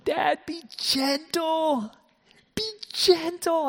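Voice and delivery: put-on high, slow, pleading little girl's voice; daughter's plea. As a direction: beg like a scared child, drawn out. Daughter pleading during splinter removal.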